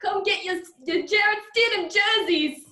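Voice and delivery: high, loud, hammed-up Boston accent; bad Boston accent. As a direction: holler like a cartoonish Boston street seller. Repeats her mocked Boston fan impression.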